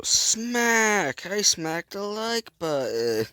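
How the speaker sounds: loud high smack, then slow voiced line; mock hero smacking the button; silly character voice. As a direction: ham it up as the game character. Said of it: "And go".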